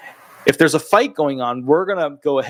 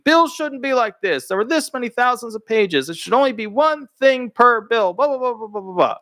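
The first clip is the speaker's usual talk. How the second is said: much higher mock Trump speech voice; Trump impression; 8 semitones higher than usual. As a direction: parody the president ranting about bills. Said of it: "you'd hear him at the speeches going".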